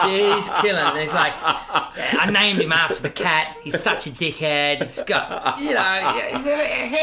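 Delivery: loud, real laughter through the words; laughing retelling. Real, not acted. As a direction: crack up while loudly mimicking the heckles. Cracking up retelling mum's set.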